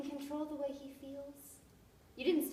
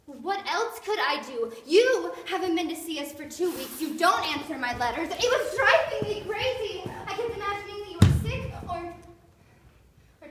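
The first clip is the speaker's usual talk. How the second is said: louder, pleading and overwrought; distressed outburst; 3 semitones higher, 8 dB louder than usual. Acted. Lover pleading with him, loud and distraught. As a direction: let the worry burst out loud.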